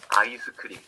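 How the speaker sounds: "아이스크림" as a-i-seu-keu-rim; five-syllable Korean pronunciation. Shown as a demonstration. Teacher counting five syllables for class, "아이스크림" as a-i-seu-keu-rim. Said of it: "Five syllables".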